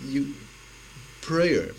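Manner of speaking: normal talking voice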